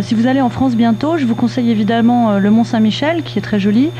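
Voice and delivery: ordinary talk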